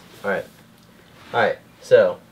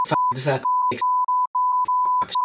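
squeal. Acted. Repeats catchphrase squealing.

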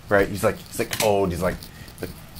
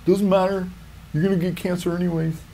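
mock dentist; 9 semitones higher, 4 dB quieter than usual. Mocking the dentist's fluoride talk.

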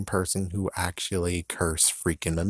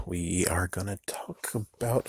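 conspiratorial whisper to the fans; 6 dB quieter than usual. Acted. Vlogger whispering the topic to Goosebumps fans, hushed. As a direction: lean in and whisper the tease.

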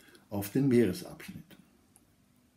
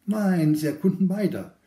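higher and louder, eager to prove him wrong; emphatic rebuttal; 8 semitones higher, 8 dB louder than usual. Acted. Author rebuts Zweifel about his settlers, eager and louder. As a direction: burst out with a keen no.

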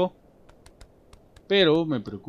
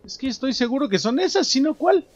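excited insistence; 12 semitones higher, 6 dB louder than usual. Real, not acted. Insisting the items are right.

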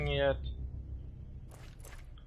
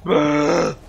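shouted hurry-up at a teammate; loud urging; 4 semitones higher, 13 dB louder, much slower than usual. Real, not acted. Gamer yelling at teammate to hurry, loud urging. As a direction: yell at them to move faster.

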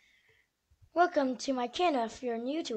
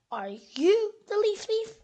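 accusing; 6 semitones higher, 5 dB louder than usual. Acted. Squirrel accuses Bird.

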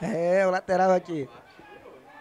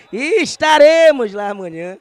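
loud emphatic announcement; 4 semitones higher, 9 dB louder, slower than usual. Shown as a demonstration.

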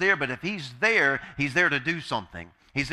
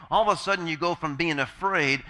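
normal talking voice

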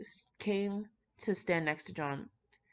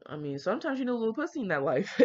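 quick, higher, offhand shrug of a line; mock Sherlock, breezy; 5 semitones higher, faster than usual. Reader voicing Sherlock answering John, quick and breezy. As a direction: toss it off like it's obvious. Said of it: "Sherlock's like".

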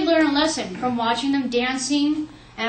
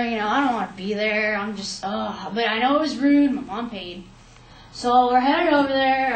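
ordinary talk

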